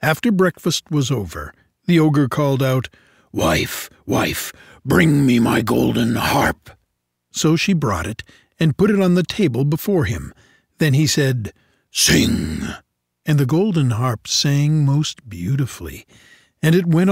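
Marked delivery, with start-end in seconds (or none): 3.3-6.7 low, slow ogre summons. Acted. The ogre calling his wife for the harp, low and slow. 11.9-12.6 low ogre command. Acted. The ogre ordering the harp to sing, low.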